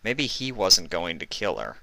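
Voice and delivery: ordinary delivery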